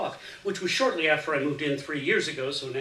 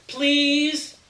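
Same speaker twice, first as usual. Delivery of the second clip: put-on much higher, louder child's begging please; loud whiny plea; 12 semitones higher, 9 dB louder than usual. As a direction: beg like a kid who won't give up. Child begs harder.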